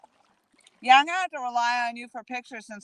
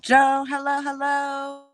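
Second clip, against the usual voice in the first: high, drawn-out hello call; 7 semitones higher, 3 dB louder, slower than usual. Real, not acted. Hailing Joe from the kayak, calling out. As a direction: holler a greeting on one held high note. Calling out to someone across water.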